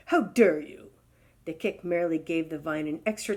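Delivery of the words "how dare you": louder, outraged; indignant. Acted. Giraffe scolding the vine, louder outrage. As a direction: spit out the insult. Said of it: "with an angry kick".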